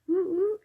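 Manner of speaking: high-pitched two-note hoot; quick high hoot of excitement; playful woo-woo. Real, not acted. Playful hoot after announcing workshop.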